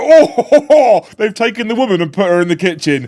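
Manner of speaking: high, loud mock-indignant ho ho ho; mock outrage. Co-host mocking the kitchen chalkboard, loud and high. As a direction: ham up fake shock at the sexism. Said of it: "I was like".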